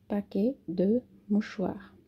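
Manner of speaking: slowly, "Paquet" without the final TE; careful, slow model phrase; slow demo. Shown as a demonstration. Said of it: "Não se fala paquete, é paquet". (devagar, "paquet" sem TE final)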